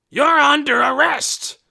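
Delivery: loud slow high arrest shout; yelled. A narrator's reading. Officer yelling the arrest at Hoyt from a distance. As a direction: announce the arrest at full volume. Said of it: "yelled Officer Gurney".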